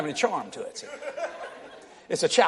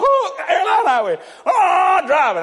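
mock wail; 10 semitones higher, 5 dB louder, slower than usual. Daughter crying in the car.

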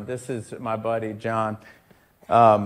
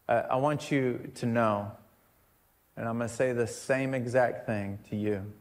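ordinary talk

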